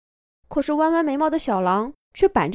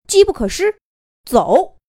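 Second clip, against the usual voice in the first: urgent; 7 semitones higher, 5 dB louder than usual. Acted. Wolf seizes the moment.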